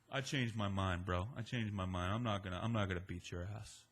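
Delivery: put-on creepy drunk. [name] turns creepy and low.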